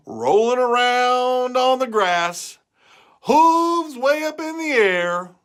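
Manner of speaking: slow, high storytelling; slow high gleeful narration; building glee. A narrator's reading. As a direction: paint the silly picture. Describing cow laughing.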